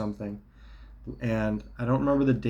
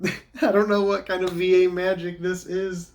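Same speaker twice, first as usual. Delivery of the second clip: high, laughing disbelief; 8 semitones higher than usual. Real, not acted. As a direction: laugh through the words. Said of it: "I'm laughing".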